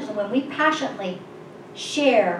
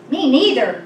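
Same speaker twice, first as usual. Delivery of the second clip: loud, higher echo of the refusal; shouted refusal; 4 semitones higher, 8 dB louder than usual. A narrator's reading. Another cave dweller joining the refusal, calling out loudly. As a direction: call it out emphatically. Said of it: "goes another".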